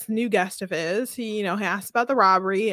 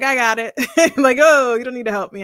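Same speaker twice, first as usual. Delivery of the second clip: mock modern man; 3 semitones higher, 9 dB louder than usual. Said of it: "Kevin ... is like".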